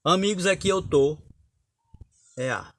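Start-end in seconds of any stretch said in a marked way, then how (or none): 0.0-1.2 higher and louder recital of amigos, aqui eu tô; declaimed verse. Shown as a demonstration. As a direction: proclaim amigos, aqui eu tô to an audience. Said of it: "Por exemplo".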